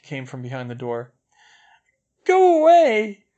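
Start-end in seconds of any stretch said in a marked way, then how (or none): 2.2-3.3 high, loud, slow tearful cry; a girl crying in a bathroom stall; whimpered. A narrator's reading. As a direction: whimper it as if pushing someone away. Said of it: "a familiar voice whimpered".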